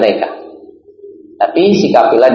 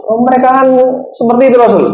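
much higher, indignant complaint; wife protesting to the Prophet, higher and indignant; angry wife; 13 semitones higher, faster than usual. Retold or imitated. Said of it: "Marah-marah".

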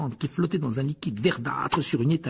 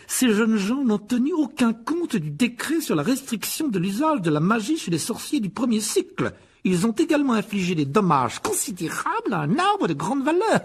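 slow, higher, mincing accuser; sneering potions master; 10 semitones higher than usual. A narrator's reading. The potions master accuses the boys before the headmaster, sneering. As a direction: drag out every complaint with relish. Voices Snape.

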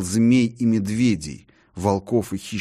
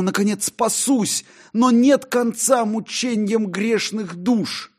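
Faustus lamenting; 14 semitones higher, 4 dB louder than usual. Acted. Faustus on stage laments damnation, high and anguished. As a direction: wail at the coming damnation. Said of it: "заговорил голосом моей совести".

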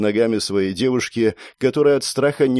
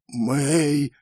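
drawn-out weak, higher call; half-fainting croak; 4 semitones higher than usual. Acted. Half-conscious boy calls Mei, croaking. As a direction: croak the name, nearly passing out. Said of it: "прохрипел в полуобмороке я".

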